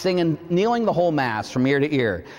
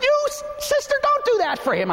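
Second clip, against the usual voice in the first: a squeaky, scandalised inner voice; inner protest. Retold or imitated. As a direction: shriek it in your head, high and exasperated.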